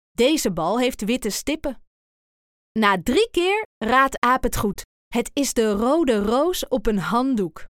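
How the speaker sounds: usual speaking voice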